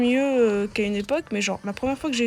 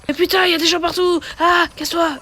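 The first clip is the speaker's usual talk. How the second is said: sudden higher, louder outburst; frustrated yelling; 7 semitones higher, 6 dB louder than usual. Real, not acted. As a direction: yell at the screen. Gamer yelling at enemies.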